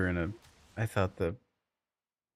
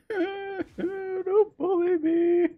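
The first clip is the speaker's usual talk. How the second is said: squeaky whimper through laughter; laughing whine; 4 dB louder, much slower than usual. Pretend. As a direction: playfully wail as if hurt. Teased, squeals back laughing.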